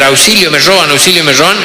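canned voice yelling for help; car alarm recording. Retold or imitated. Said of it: "una grabación que empieza a gritar".

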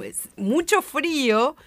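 MUCHO drawn out, high-pitched; emphatic MUCHO. Real, not acted. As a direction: stretch and lift "mucho" for real emphasis. Stressing how cold it is. ("mucho" enfático, alargado y agudo)

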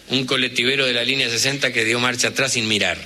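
usual speaking voice

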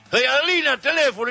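loud, high holler for a neighbour; shouted call. Retold or imitated. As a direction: imitate yelling someone to the phone. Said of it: "que gritaba por el teléfono".

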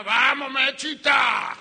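high, loud drawn-out holler; hammed-up husband's shout, stretched out; impatient yell. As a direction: call her from the other room at full voice. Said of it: "le gritaba de la cama".

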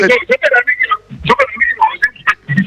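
garbled phone line, like speaking underwater; broken robotic phone audio. Real, not acted. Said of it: "Está como bajo el agua".

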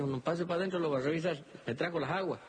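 paisano santiagueño, voz mucho más aguda. Acted. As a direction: saluda cantadito, como viejo de campo. Samaniego le habla. (Samaniego saluda a su visitante con voz aguda de paisano)

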